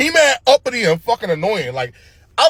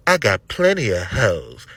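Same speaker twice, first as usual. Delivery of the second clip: low, slow laid-back drawl; 8 semitones lower, slower than usual. Retold or imitated. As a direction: lean back and drawl like a cool pimp.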